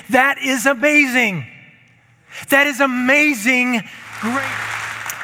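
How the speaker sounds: rising preacher climax. Acted. Preacher rejoicing before the congregation. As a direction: let the wonder lift you.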